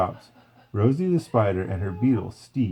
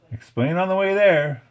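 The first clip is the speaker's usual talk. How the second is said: higher, louder eager voice; keen suggestion; 8 semitones higher, 5 dB louder than usual. A narrator's reading. Slim urging Flick to explain on the way. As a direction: suggest it keenly as the stick bug. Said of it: "Slim suggested".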